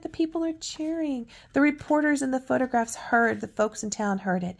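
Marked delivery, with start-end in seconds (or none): none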